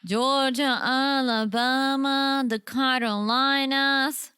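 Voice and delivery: very slow, drawn-out Southern drawl; [name]'s Southern accent. Shown as a demonstration. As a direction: show off a put-on Southern accent, stretching every place name. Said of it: "that's [name]'s Southern accent".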